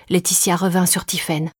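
plain reading voice

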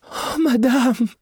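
a long high plaintive madame; pleading. A narrator's reading. Maid begging her departing mistress, long high plea. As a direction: beg one last time. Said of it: "supplia la jeune fille".

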